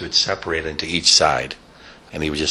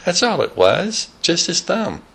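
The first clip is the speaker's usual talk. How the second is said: laughing; 11 semitones higher than usual. Real, not acted.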